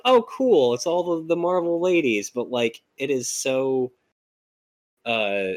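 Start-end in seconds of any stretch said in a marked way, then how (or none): 0.1-2.3 mock enthusiasm. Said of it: "it was like".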